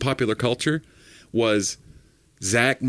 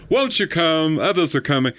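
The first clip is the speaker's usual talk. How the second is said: a light North Carolina preacher's invitation, higher; Billy Graham preacher voice; 6 semitones higher than usual. Retold or imitated. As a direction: call the crowd forward like an old Southern evangelist. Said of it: "I can do a pretty good preacher Billy Graham voice".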